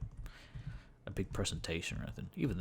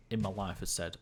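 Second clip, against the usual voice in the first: ordinary delivery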